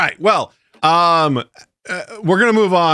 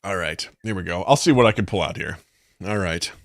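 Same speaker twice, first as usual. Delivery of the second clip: normal talking voice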